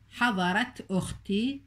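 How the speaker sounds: حضرت slowly with a clear t, flowing into أختي; slow down and sound the t of حضرت; slow demo. Shown as a demonstration. Teacher repeating the example slowly for the class. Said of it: "التاء تلفظ وهي في درج الكلام".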